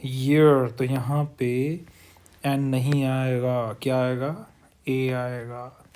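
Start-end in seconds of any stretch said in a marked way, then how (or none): 0.0-0.7 ईयर said loud and clear as year; model pronunciation. Shown as a demonstration. Teacher repeating ईयर for students, loud. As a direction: give ईयर loud and firm. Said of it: "ये होती है इसकी pronunciation".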